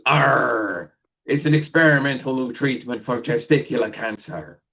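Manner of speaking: gruff pirate growl with a high Arr. Retold or imitated. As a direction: play the pirate in the joke. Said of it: "And the pirate goes".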